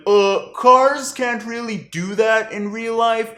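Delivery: slow, doubtful nitpicking voice; skeptical. Retold or imitated. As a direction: drawl it like a know-it-all skeptic. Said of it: "go like".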